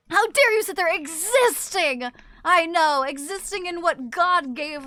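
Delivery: hammed-up "how dare you" scolding; mock indignation. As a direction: scold with theatrical offence.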